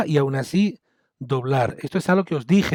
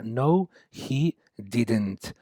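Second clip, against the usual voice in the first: No, he didn't said very slowly and softer; slow model answer; 5 semitones lower, 6 dB quieter, much slower than usual. Shown as a demonstration. Teacher modelling the no answer slowly for students. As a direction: drag out the negative answer quietly. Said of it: "Y la respuesta negativa sería".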